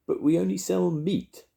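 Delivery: high, puzzled protest; confused butcher. A narrator's reading. Porky Percy answering Grandma, high puzzled protest.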